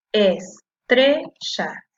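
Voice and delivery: "estrella" very slow, in three separate syllables. Shown as a demonstration. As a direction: break "estrella" into "es", "tre", "lla". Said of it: "La separo en sílaba".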